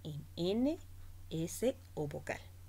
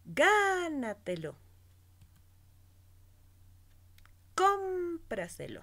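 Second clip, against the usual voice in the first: GÁ of Gánatelo and CÓM of Cómpraselo high and held; stress on first syllable; 11 semitones higher, 3 dB louder than usual. Shown as a demonstration. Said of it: "en la cuarta o quinta sílaba". (acento alto y alargado en GÁ de Gánatelo y CÓM de Cómpraselo)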